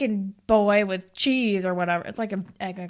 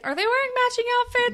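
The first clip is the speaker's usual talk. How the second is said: delighted surprise; much faster than usual. Real, not acted. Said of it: "excited question".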